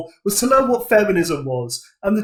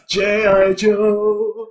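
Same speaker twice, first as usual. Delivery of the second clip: Geordie drawl, stretched right out; higher, very slow Geordie caricature; mock Geordie accent; 5 semitones higher, much slower than usual. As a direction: drag the name out in mock Geordie. Mock Geordie drawn-out name.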